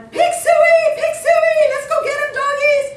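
very high, loud hollering hog call. Retold or imitated. Hunters chasing their dogs through the bush, shrieking hog call. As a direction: yell after the dogs like a hunter. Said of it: "more hunters chasing after going".

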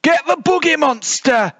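littlest bear's rallying yell; squeaky-high battle order; high-pitched bellow. A narrator's reading. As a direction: bellow it high, like a small ringleader. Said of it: "shouted the small one".

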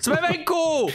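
triumphant cheer. Real, not acted. Cheers on escaping.

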